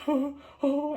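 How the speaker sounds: high panicked gasps of oh. Retold or imitated. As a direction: gasp it out in panic.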